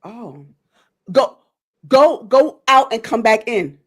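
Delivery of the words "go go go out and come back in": raised, high voice over a bad connection; calling out instructions. Real, not acted. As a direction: spell it out slowly and loudly for someone who can't hear. Host instructs guest over failing connection.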